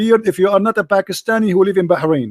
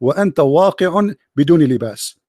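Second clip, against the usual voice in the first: poem recitation; 6 semitones lower than usual. Acted. Recites improvised classical Arabic poem.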